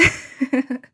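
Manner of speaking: loud, amused laugh; real burst of laughter. Real, not acted. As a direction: laugh heartily at yourself. Laughs after saying she loves Liz.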